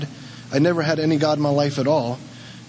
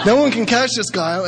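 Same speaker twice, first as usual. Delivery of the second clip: laughing; 7 semitones higher than usual. Real, not acted. Marvelling at one-legged football player.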